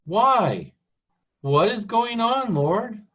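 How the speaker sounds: high, pleading cry; anguished questioning. Acted. Preacher voicing the prophet asking the Lord why, anguished.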